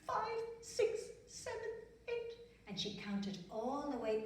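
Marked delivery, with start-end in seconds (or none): 0.1-2.4 high, small, frightened counting; a shaky-feeling high count, too scared to speak up. Acted. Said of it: "she was still so scared".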